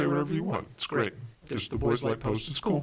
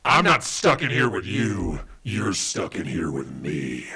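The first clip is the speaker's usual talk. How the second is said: menacing movie-quote delivery; 2 semitones lower, 4 dB louder, slower than usual. Acted. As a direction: draw it out like a villain, sinking in pitch. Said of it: "Wow, that's menacing".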